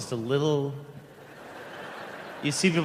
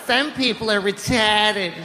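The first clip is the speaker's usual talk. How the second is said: high, slow Boston schoolteacher; Boston-accented teacher; 6 semitones higher than usual. Acted.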